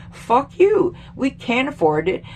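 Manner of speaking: usual speaking voice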